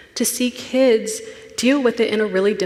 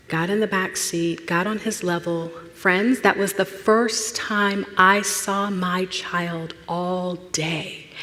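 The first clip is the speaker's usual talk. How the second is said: ordinary talk, nothing marked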